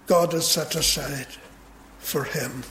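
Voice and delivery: ordinary talk, nothing marked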